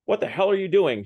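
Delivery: mild storybook shout; narrated shout. A narrator's reading. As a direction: read it as an indignant outburst, not full volume. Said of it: "shouted wa-, the intelligent man".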